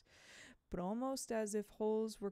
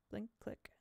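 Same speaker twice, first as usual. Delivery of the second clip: whispered; 6 dB quieter than usual. A narrator's reading. Camera sound whispered in narration.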